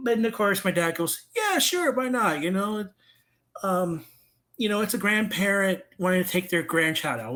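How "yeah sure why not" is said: high, easygoing imitation of dad; breezy agreement. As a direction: agree without a second thought. Acting dad's carefree agreement.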